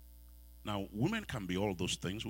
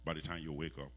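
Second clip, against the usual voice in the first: gentle; 6 semitones lower, 7 dB quieter than usual. Acted. Voicing God gently.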